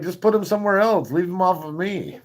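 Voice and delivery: hammed-up whining at the nurse; high mock plea to keep her off; mock whine. Retold pleading to nurse.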